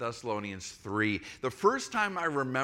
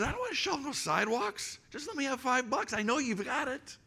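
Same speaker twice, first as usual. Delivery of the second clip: put-on much higher, whiny demanding young man; voicing the guy who refuses, higher and insistent; stubborn young guy; 13 semitones higher than usual. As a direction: impersonate the hungry guy pushing back. Homeless guy refuses shoveling.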